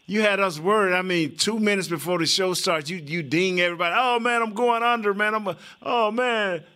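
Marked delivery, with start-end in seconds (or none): 4.0-6.6 mock panic. Mimics friend texting before surgery.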